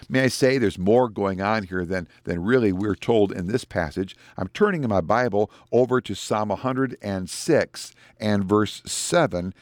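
usual speaking voice

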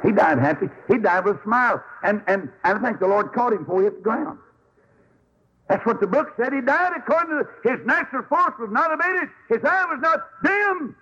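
preacher rising to a joyful peak; preaching at full force. Acted. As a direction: lift the whole crowd up. Preaching climax on Moses' death.

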